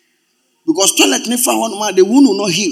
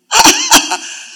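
high laughing burst. Real, not acted.

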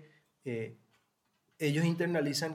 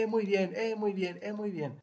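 higher, hollow repeated cheering; mock cheerleader; 8 semitones higher than usual. Parent as a cheerleader praising everything, mock. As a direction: parrot empty praise like a cheerleader. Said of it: "ser porrista".

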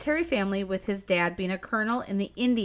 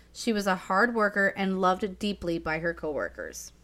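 usual speaking voice

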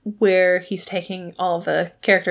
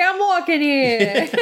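put-on hammy New York accent, higher; bad New York accent; 6 semitones higher, slower than usual. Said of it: "bad New York accents".

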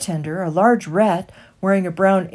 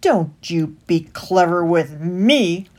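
slow, louder grumbling rat; scowling rat; 6 dB louder than usual. A narrator's reading. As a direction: warn him off, scowling. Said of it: "The rat scowled".

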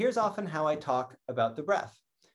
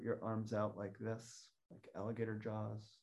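guided breathing; 14 dB quieter than usual. Shown as a demonstration. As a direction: lead the breath gently, leaving long pauses. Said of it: "Welcome to do the alligator breath along with me".

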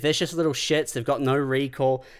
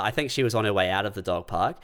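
normal talking voice

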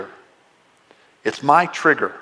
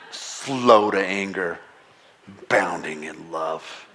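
low, slow, resentful reading of Jonah's prayer; angry Jonah; 7 semitones lower, 3 dB quieter, slower than usual. Acted. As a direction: spit out God's mercy like a complaint. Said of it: "He's saying it in an angry tone".

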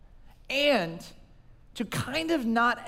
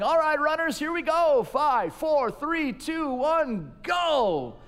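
race announcer; 6 semitones higher, slower than usual. Retold or imitated. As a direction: call the start like a race announcer. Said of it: "the announcer going".

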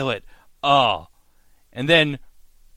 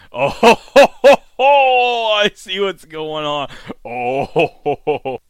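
knowing laugh; 3 semitones higher, 9 dB louder than usual. Real, not acted. Vlogger laughing at Nadia's come-on, knowing and loud. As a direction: chuckle like you caught them flirting.

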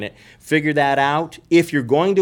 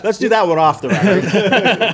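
laughing; 7 semitones higher, 6 dB louder than usual. Real, not acted. Joking after a confusing tangent.

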